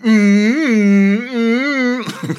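loud, high drawn-out "mmm"; bratty hum. Acted. Prince taunting his rival, bratty hum. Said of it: "in total brat mode".

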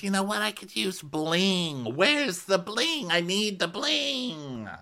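very high, slow airy Denise voice; imitating Denise. Host mocking Denise asking for bling, very high slow mock. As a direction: drag out the bling wish in a squeaky high mock. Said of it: "And she goes".

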